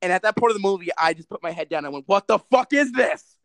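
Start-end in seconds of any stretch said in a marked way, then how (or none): 2.1-3.4 slow, loud, exasperated shout of disbelief; outburst in the theater. Retold or imitated. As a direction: blurt it out loud at the screen. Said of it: "out loud".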